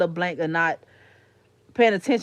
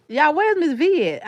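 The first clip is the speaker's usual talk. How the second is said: calling out; 7 semitones higher, 6 dB louder, slower than usual. Real, not acted. Calling out to the chat.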